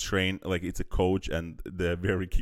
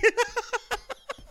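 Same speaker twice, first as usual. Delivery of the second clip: high-pitched laugh. Real, not acted.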